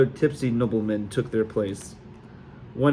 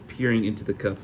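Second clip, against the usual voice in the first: plain reading voice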